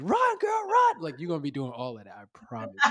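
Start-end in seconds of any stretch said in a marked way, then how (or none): 0.0-1.0 very high-pitched urgent yell; yelling at the screen. Acted. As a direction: yell a warning like a worked-up movie watcher. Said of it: "you're gonna be yelling at the screen".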